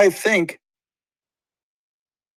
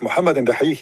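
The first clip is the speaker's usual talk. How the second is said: ordinary delivery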